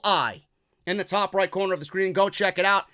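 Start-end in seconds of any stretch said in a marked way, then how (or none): none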